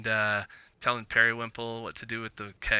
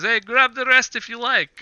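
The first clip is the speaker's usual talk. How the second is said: high, loud character voice; raised, louder voice of a generous host; Ergel, offering drinks; 11 semitones higher, 9 dB louder than usual. Acted. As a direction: call out an offer to the table. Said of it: "Ergel grabs a couple of them and says".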